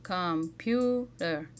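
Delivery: "Computer" slowly, syllable by syllable, with a pure "pu"; slow down and separate the vowels of "Computer"; "Computer" drawn out, middle vowel clearest; clear stressed vowel. Shown as a demonstration. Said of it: "Compare the first and last vowel sounds".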